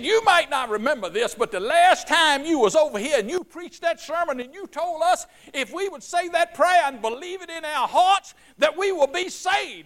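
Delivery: high, loud, rising joy; excited. Retold or imitated. Old woman telling the preacher her news, excitedly. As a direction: tell it bursting with excitement. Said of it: "she was just excited".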